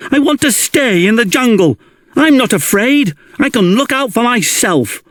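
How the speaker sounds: put-on high, fast, pleading boy's voice; a young boy insisting he can stay, pitched high; defiant boy. A narrator's reading. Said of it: "cried Mowgli".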